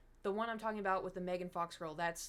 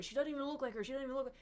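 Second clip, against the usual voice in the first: higher, scoffing mock voice; mocking internet guys; 5 semitones higher, much faster than usual. Mocking guys online who deny she looks like Megan Fox, high and scoffing. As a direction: mimic the dismissive guys online, pitched up. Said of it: "guys on the internet were".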